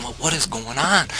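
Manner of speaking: high-pitched bewildered question; imitated freak-out. Friend asking about his barking dog, freaking out. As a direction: mimic a rattled friend, pitch up. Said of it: "he's freaking out".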